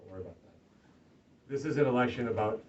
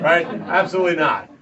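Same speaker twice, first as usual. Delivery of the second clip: usual speaking voice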